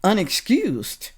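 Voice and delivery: slow, drawn-out mocking echo; slow sarcastic echo of the word; sarcastic repetition. Real, not acted. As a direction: savor the word Unexcused with scorn. Repeats guest's word sarcastically.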